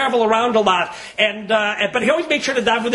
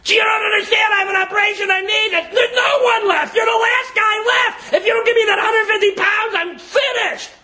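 desperate pleading; 13 semitones higher than usual. Acted. Collector begging the last house for operation money, crying out desperately. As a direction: cry it out as a last, desperate appeal.

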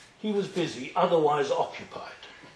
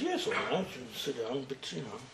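soft grudging reply; 3 semitones lower, 11 dB quieter than usual. Retold or imitated. Yaakov grudgingly agrees.

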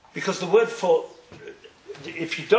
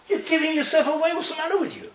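mother scolding; 8 semitones higher, faster than usual. Retold or imitated. Mother scolding son for slip.

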